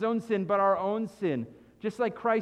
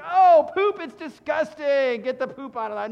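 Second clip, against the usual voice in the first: loud, high "ew" of disgust that eases off; 6 semitones higher, 9 dB louder than usual. Pretend. Preacher voices everybody reacting to poop, loudly disgusted. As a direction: recoil in loud disgust, then settle.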